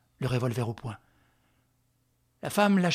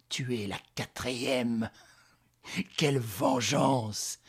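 slow, rasping, broken into short bursts; hoarse, halting voice; slower than usual. Acted. Powerless enraged woman vows revenge, hoarse and halting. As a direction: grind out the vow haltingly. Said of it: "articula d'une voix rauque et saccadée".

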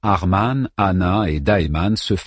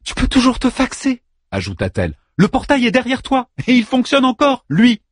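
mocking, sharp. Anna mocking Daeman.

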